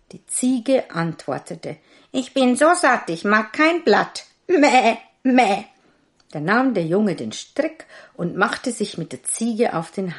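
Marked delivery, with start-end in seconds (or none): 2.1-5.6 high, loud goat voice bleating Meh; a smug goat bleating its lines. A narrator's reading. Goat answering the tailor in a high bleat.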